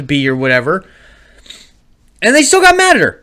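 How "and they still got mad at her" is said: very high, loud protest; exasperated exclamation. Real, not acted. Parent defending the kid to friend, incredulous and exasperated. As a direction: cry out at the unfairness.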